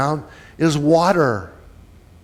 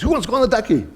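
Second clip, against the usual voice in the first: sparky invitation; 6 semitones higher, much faster than usual. Retold or imitated. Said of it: "with a spark in his voice".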